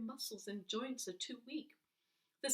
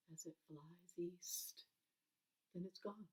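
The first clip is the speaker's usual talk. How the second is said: whispered, trailing away; hushed whisper as the plane vanishes; fading whisper; 12 dB quieter than usual. A narrator's reading. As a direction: let it fade to nothing.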